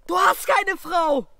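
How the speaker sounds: loud, drawn-out retort; loud, slow, indignant correction; exasperated shout. Acted. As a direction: shout it back at your friend, exasperated. Exasperated retort to last words.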